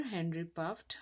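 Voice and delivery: ordinary delivery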